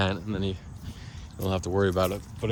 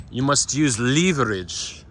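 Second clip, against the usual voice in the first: a hint of German accent, slow, leverage as LEE-ver-idge; lightly imitating a German friend, leverage said LEE-ver-idge; German accent quote; 3 semitones higher, slower than usual. German man at funeral advises digging, light German accent. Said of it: "heard leverage pronounced as leverage".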